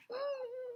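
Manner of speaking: quick high shocked gasp; sudden startled intake of breath; sharp gasp. Acted. AJ discovers missing photo.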